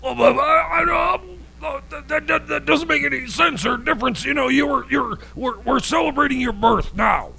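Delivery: high-pitched panicky babble at Jesus; flustered protest. Acted. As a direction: sputter in a panic, voice jumping up. Flustered host pleading with Jesus.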